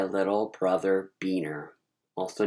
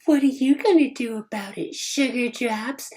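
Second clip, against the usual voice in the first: sassy challenge; 13 semitones higher, 5 dB louder than usual. Retold or imitated. Melanie daring her cousin, high sassy taunt. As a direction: dare him to do something. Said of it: "She had that attitude".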